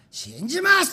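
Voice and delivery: declared loud and high; loud declaration. Retold or imitated. Not what the president could say, a loud mock declaration. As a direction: proclaim it boldly. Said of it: "大きな声でそんなこと言えない".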